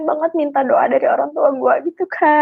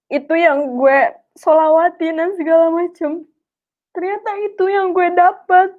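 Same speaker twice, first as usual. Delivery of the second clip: high, tearful voice with long pauses; 5 semitones higher than usual. Real, not acted. Speaker marvelling at getting the very car, tearful. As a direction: push the words out through real tears.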